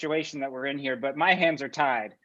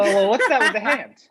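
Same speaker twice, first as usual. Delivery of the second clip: high, loud alarmed challenge; louder and higher, taken aback; 5 semitones higher, 6 dB louder than usual. Acted. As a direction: cut in, startled by the gesture. Dean alarmed by teacher's hand motion.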